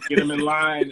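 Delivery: high, loud, hammed-up barked order; mock drill sergeant. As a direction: play the strict sergeant mom, half joking. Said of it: "raised very militantly".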